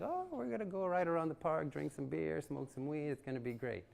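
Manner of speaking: picture an easy fun day out; happy-go-lucky anticipation; naive excitement. Retold or imitated. Naive self imagining casual ride.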